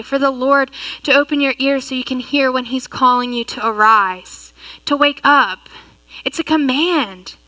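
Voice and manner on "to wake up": ordinary talk, nothing marked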